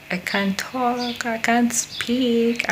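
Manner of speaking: mock whine at her own struggle; whiny self-mockery. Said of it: "You see what I'm talking about?".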